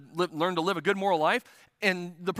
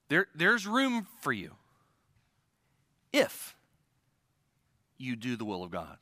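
soft pastoral invitation; tender invitation; 6 semitones lower, 3 dB quieter than usual. Acted. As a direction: invite them in gently, almost whispering the condition.

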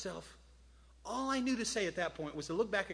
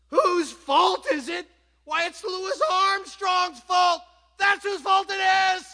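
furious high shouting, far above usual talk; ticked-off tirade; 13 semitones higher, 15 dB louder than usual. Acted. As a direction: rant at full volume, outraged. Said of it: "I'm ticked. And this is what I'm gonna say".

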